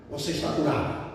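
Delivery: low, softened assurance; quiet, settled certainty; calm declaration of faith. Acted. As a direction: answer the complaint quietly and very low. Models faith reply to complaint.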